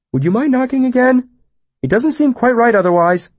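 high, odd, polite eccentric; a strange, childlike hermit. Acted. Puppet's odd voice.

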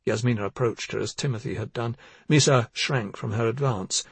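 usual speaking voice

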